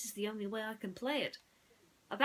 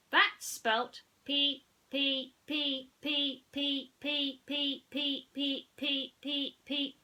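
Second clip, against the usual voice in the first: higher, loud stuttering electronic toy; stuck robot voice; 7 semitones higher, 6 dB louder, much slower than usual. Acted. Mister Spell glitches while spelling, robotic stutter. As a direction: repeat like a glitching machine.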